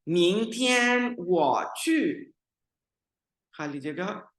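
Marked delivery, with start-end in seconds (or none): 0.1-2.3 slow, higher Mandarin read-out of मिंग टियान वो चू; teacher reading Mandarin sentence aloud; Mandarin model reading. Shown as a demonstration. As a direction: read it slowly for the students. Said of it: "sentence को एक दफा पढ़ता हूँ आपके लिए".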